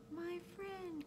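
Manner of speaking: high, soft little speck voice; small faraway-sounding high voice; faint tiny voice. A narrator's reading. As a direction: speak as a tiny creature, high and very quiet. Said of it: "The voice was so faint".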